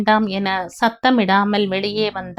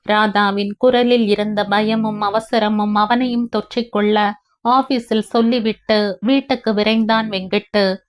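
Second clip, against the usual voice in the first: usual speaking voice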